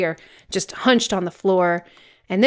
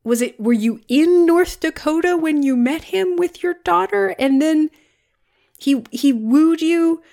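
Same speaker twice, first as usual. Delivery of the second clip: incredulous; 7 semitones higher, 4 dB louder than usual. Acted. Mock Jet Li questioning her.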